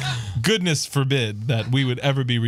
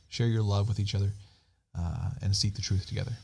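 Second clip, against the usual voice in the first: soft, earnest spoken prayer; 3 semitones lower, 5 dB quieter than usual. Real, not acted. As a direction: pray quietly and sincerely. Leads an opening prayer.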